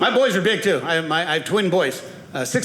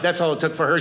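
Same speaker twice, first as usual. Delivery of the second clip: ordinary delivery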